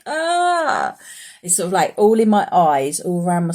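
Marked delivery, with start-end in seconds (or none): 0.1-1.0 high drawn-out "aah"; strained head "ah". Retold or imitated. Vlogger acting out her pounding head, high drawn-out cry. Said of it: "my whole head is like".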